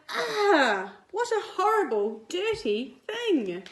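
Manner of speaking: high, loud disgusted parents; disgusted shout. Acted. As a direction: recoil from dirty Bunny with a loud high cry. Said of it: "They shouted".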